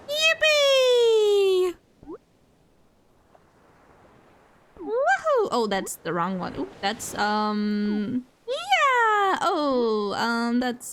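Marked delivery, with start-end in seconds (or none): 0.1-5.5 high squealing yippee; excited cheer. Real, not acted. Cheering a catch. 8.5-9.4 high gleeful yeah; excited cheer. Real, not acted.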